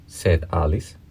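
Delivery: plain reading voice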